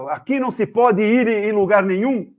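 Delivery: loud, high indignant complaint; retold protest. Tourist argues with museum attendant, retold loud protest. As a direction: protest loudly, re-enacting the argument. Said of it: "Comecei a dar esse papo assim".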